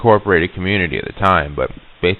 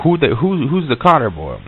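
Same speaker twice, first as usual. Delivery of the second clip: higher-pitched, baffled question; retold bafflement; 7 semitones higher than usual. Asking the local who this boy is, baffled. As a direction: ask it puzzled, pitch jumping up.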